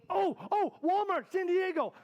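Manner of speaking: high, loud burst of remembering; sudden recall. Real, not acted. Speaker prompted by audience about Walmart, real excited recall. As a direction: cry out as it comes back to you.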